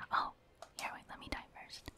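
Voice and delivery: usual speaking voice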